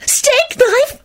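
very high, quivering fervour; unhinged high-pitched rapture; fervent, breathed. A narrator's reading. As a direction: breathe it like a deranged admirer. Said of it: "she breathed fervently".

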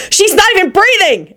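high-pitched alarmed screech. A narrator's reading. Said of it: "Angel screeched".

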